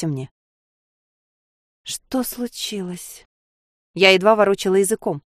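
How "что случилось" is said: slow, soft, dazed. A narrator's reading. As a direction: barely get the words out. Dazed, barely able to speak.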